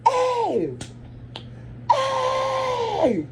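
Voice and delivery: long, high-pitched holler; re-enacted high yell across a street. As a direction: call out "hey" high and drawn out, imitating a yell. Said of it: "I mean, I'm yelling, y'all, I'm yelling".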